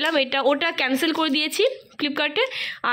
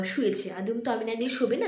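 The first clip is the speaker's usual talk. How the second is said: ordinary talk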